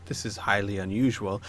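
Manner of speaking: soft, slow, low noblewoman voice; Lady Trask. Acted. Baroness questions Lady Celine about unexpected company, hushed disapproval. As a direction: quietly question the company, drawing out the words. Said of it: "Lady Trask looks at all of you".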